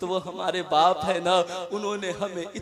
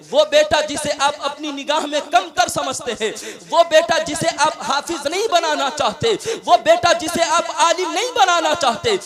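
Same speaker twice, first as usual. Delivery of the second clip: climbing louder and higher with each phrase; building preaching; 6 semitones higher, 7 dB louder than usual. Acted. Preacher praising the overlooked son, building to a loud climax. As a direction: hammer the repeated refrain home, building.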